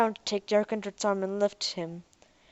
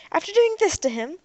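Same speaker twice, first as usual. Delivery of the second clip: indignant; 11 semitones higher, 5 dB louder than usual. Acted. Ariel pressing the robot.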